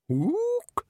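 very high ape call; a single bright ape hoot; the librarian's high ook; orangutan ook. Acted. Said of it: "said the librarian".

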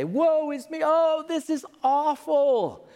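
high, slow, wailing lament; hammed-up melodramatic woe; woeful kings. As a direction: wring your hands and wail. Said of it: "stand off and say".

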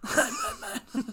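slow laughing mock Batman. Batman impression.

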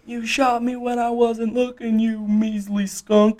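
put-on high, slow squawking old prospector. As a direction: crow it like a sore old miner. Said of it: "the old prospect".

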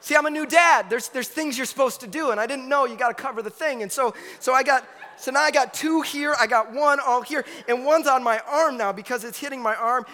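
laughing in disbelief at the mess; flustered retelling. Retold or imitated. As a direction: relive the diaper disaster in a panic. Frantic new dad retells mess.

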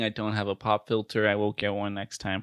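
ordinary talk, nothing marked

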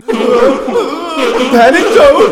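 very slow, high, loud announcer drawl; drawn-out jingle. Pretend. Host announcing the segment, stretched high jingle voice. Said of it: "Play the thing".

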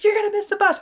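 high-pitched imitated mom's call. Mom hurrying her son for the bus, high call. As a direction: call it out high, to another room.

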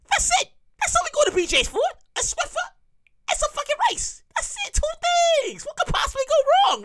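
high-pitched, lightly squeaked mimicry; mock innocent promise. Mimicking the promised quick errand.

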